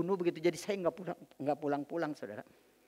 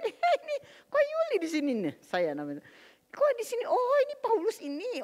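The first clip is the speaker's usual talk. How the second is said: much higher, exclaiming surprise; surprised pastor; 4 dB louder than usual. Retold or imitated. Pastor spotting Yuli at mama's house, high surprised exclaim. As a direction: squeal it like a startled friend.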